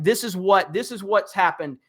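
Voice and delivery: ordinary delivery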